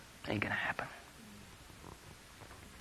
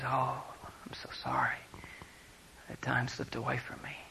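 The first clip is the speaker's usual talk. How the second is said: hushed apology; 14 dB quieter than usual. Retold or imitated. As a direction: apologise very quietly, unhurried. Retold soft apology.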